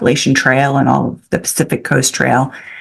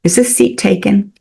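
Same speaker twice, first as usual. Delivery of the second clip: lightly imitated Irish accent, higher pitch; a hint of an Irish lilt, pitched up; 5 semitones higher than usual. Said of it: "She had the most beautiful Irish accent".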